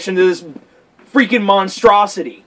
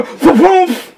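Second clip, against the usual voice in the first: high, loud cartoon thwomp; mouth-made crash-down sound effect; sudden punchy impact noise; imitated landing noise; 10 semitones higher, 5 dB louder than usual.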